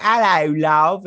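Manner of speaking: high, loud mock Cockney greeting; mock British accent. American imitating British people greeting, hammed-up British accent. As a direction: belt out a hammed-up British hello. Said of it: "a lot of British people are all like".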